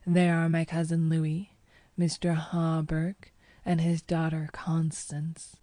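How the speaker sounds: usual speaking voice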